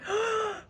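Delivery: sharp, high gasp of disbelief; mock astonished gasp; shocked gasp. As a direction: suck in a breath, acting out surprise. Retold reaction to surprise call.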